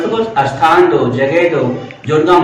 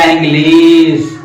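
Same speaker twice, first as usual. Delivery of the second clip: "English" drawn out long on लिश; exaggerated elongated "English"; wrongly overstretched; 3 dB louder, much slower than usual. Shown as a demonstration. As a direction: drag out "English" slowly, holding the second vowel. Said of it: "ऐसा नहीं".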